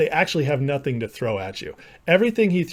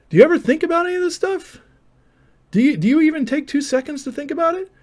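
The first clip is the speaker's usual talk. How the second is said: high, rushed, incredulous questions; exasperated questioning; 12 semitones higher, 4 dB louder, faster than usual. Real, not acted. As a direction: fire off high-pitched, disbelieving questions. Berating an opponent in debate.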